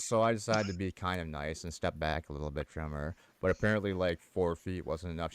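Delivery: usual speaking voice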